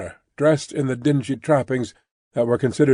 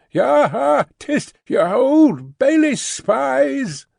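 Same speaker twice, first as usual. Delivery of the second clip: gleeful jeer; 11 semitones higher, much slower than usual. Acted. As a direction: jeer along with the mob. Cruncher jeering with the crowd.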